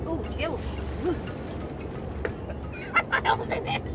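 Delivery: ordinary talk, nothing marked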